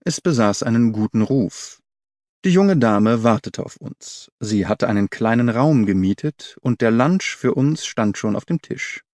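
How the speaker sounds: plain reading voice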